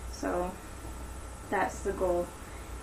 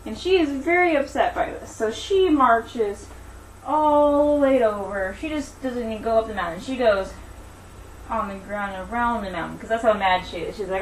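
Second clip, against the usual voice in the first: animated storytelling, higher than chat; story narration; 6 semitones higher, 6 dB louder than usual. Acted. Teacher telling Daisy and the giant to children, animated story narration.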